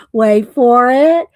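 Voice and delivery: drawn-out playful tease; teasing suspense. Real, not acted. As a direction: build suspense slowly. Teasing a funny reveal.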